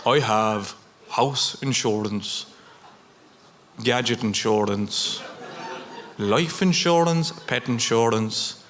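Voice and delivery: put-on slow, low, smug boasting older colleague; smug older man. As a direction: list each insurance proudly and slowly, like an older man showing off. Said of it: "One of the older fellas was showing off".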